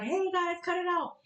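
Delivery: high, hammed-up teacher calling the class to order; mock teacher scolding. Mom imitates a teacher breaking up a fight on camera, high and mock-stern. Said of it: "the teacher like".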